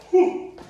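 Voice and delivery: a long high yawning whew; big yawn. Real, not acted. Tired trainer yawning out loud to the class. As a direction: yawn out loud mid-class, can't hold it. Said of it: "Sorry, yawning".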